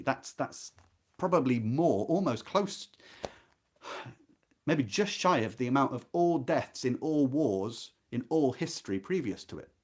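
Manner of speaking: normal talking voice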